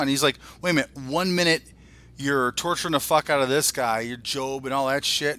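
ordinary delivery

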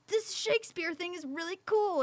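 high, loud, excited gush. Retold or imitated. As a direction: share a nerdy discovery with delight. Gushing to boyfriend on phone.

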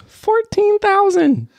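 drawn-out high disbelief; incredulous. Acted. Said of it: "Fourteen thousand modems in use".

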